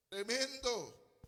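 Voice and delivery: usual speaking voice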